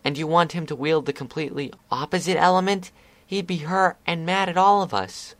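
lightly voiced upset filly; upset protest. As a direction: argue hard to protect a friend. Scootaloo argues with Discord.

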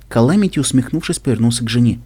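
plain reading voice

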